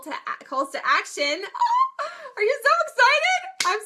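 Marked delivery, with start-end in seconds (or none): 1.5-2.0 squealing burst of laughter, high and loud; a giddy shriek of a laugh; bright high giggle; high excited laugh. Real, not acted. Announcing calls to action. 2.0-3.8 high, loud squeal of teasing excitement; gushing, hammed-up glee; mock excitement. Hyping viewers for calls to action.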